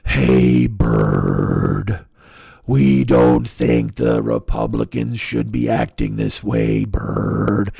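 deep, slow, deliberate puppet drone; deliberate Snuffleupagus voice. Retold or imitated. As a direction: drag each word out like a sleepy muppet. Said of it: "these deliberate ways of speaking".

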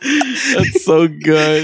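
slowly, through laughter; laughing. Real, not acted. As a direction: say it while cracking up. Laughing at the story.

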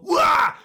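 sudden loud "Uah!" to frighten; startling scare yell. Acted. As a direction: jump out and yell, high and loud. Acting out scaring someone.

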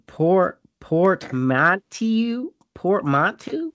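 portmanteau sounded out slowly, rising; unsure pronunciation. Real, not acted. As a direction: portmanteau tried syllable by syllable, unsure. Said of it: "what the fuck is that word".

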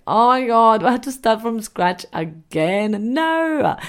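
loud, high oh my God then a wailed no; dismay at being knocked back; dismayed cry. Retold or imitated. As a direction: groan at starting over, loud and high. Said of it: "We're like".

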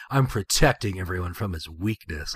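low, subdued emo brooding; mock emo. Podcaster imitating a character gone full emo, low mock brooding. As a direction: brood it quietly, sunk down low. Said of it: "full D V N R emo".

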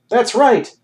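high, loud, delighted cry; ecstatic agreement. A narrator's reading. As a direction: throw it out while hugging him. Patient embraces doctor.

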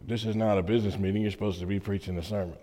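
low, quiet aside, lightly voicing a doubter; mock the grumbling churchgoer under your breath, quick and low; imitated congregant objection. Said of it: "some of y'all are like".

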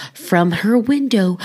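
usual speaking voice

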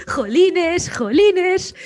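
Spanish exclamation. Acted.

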